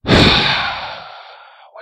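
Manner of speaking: heavy groaning exhale; loud defeated sigh. Real, not acted. Gamer after wasting supplies, loud defeated sigh. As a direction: let out a long loud deflated sigh.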